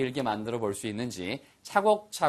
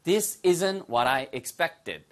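very slow, expected clear; this isn't what I expected, laid out word by word; slow model sentence; much slower than usual. Shown as a demonstration. Teacher modelling the sentence slowly for learners. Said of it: "발음 하나 주의하세요".

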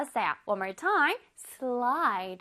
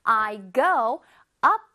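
slow example read; 3 dB louder, much slower than usual. Shown as a demonstration. Teacher reading example to children, very slow. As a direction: read "I go up and down on the seesaw" very slowly. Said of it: "One more time".

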